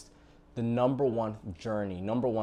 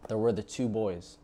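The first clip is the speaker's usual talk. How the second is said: normal talking voice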